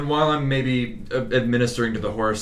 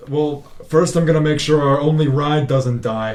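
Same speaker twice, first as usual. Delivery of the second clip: ordinary delivery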